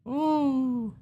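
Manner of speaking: squeaky high ooh; a delighted falsetto squeak; high squeal. Real, not acted.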